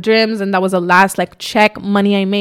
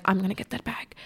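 hushed resolve; 13 dB quieter than usual. Retold or imitated. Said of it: "I'm like".

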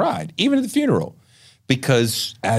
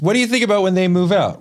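higher-pitched imitation of a neighbor; inquisitive neighbor; 8 semitones higher, 3 dB louder than usual. Neighbor asking a parent about kids leaving, higher-pitched mimicry. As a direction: put on a neighbor's eager question.

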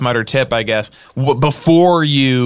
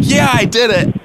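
triumphant cheer; 10 semitones higher, 3 dB louder than usual. Pretend. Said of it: "you're like".

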